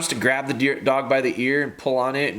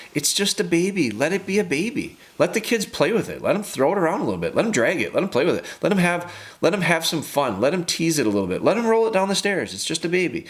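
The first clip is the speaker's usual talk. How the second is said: higher, breezy sing-song mockery of a lax parent; mock carefree parent; 6 semitones higher than usual. To a caller, mocking a lax parent, in a higher sing-song voice. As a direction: ham up a parent who shrugs everything off.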